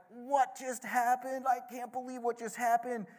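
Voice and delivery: amazed disbelief. Retold or imitated. Officials crowding the three men, stunned disbelief. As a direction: sound like you can't believe your eyes.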